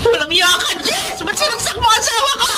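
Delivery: high accusing shriek; wife screaming at the attacker; horrified outcry. Acted. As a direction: scream at the killer in shock. Wife sees husband stabbed.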